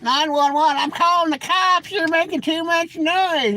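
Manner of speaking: high, slow, mock outraged neighbor calling the cops; angry city neighbor. Neighbor threatening to call the cops, mock outrage, high and slow. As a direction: whine it out like a fed-up suburban neighbor. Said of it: "they'd be like".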